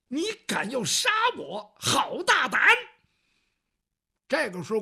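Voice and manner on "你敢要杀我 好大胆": high, indignant cry of disbelief; outraged. Acted. Eunuch threatened with death, shrieking in outrage. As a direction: bristle at the insolence of a threat. Guo Laowu threatened by intruder.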